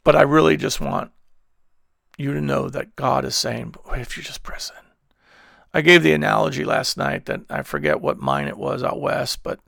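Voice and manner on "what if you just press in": whispered question. Acted. Preacher voicing God to listeners, soft whisper. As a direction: whisper it softly, much quieter.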